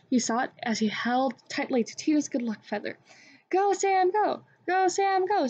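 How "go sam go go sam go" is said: high-pitched rallying cheer; eager chant, pitched well up. A narrator's reading. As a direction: cheer a friend on. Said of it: "cheered Tito and the Skyhawks".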